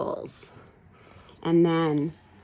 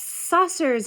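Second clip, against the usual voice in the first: plain reading voice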